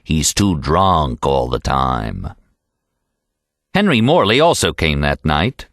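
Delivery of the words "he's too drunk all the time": low, slow sneer tossed off to one side; sneering aside. Acted. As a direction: drop your voice and sneer it as an aside. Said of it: "Aside, sneering".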